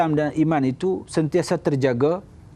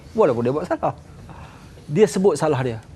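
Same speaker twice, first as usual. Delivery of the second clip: ordinary talk, nothing marked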